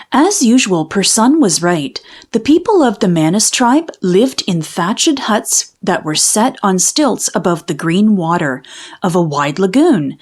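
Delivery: usual speaking voice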